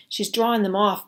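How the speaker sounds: ordinary delivery, nothing marked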